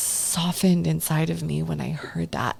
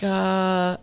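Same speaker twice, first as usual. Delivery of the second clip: ordinary delivery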